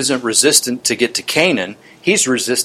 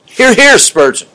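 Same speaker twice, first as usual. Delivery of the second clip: enthusiastic cheer; 10 semitones higher, 5 dB louder than usual. Real, not acted. Preacher cheers the quoted line.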